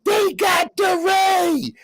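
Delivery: high, slow, drawn-out shout of alarm; imitated panicked yell. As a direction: mimic the colleague shouting that DeRay has been taken. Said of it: "his colleague starts yelling".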